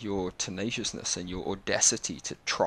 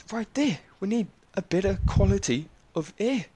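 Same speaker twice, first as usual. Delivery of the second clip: higher, louder enthusiastic pitch; excited rant; 7 semitones higher, 4 dB louder than usual. Real, not acted. Vlogger hypes the kid as climate spokesman, high and loud.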